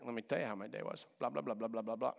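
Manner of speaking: mock a partner rattling off their day; droning spouse. Pastor mimicking a spouse monologuing after work, droning.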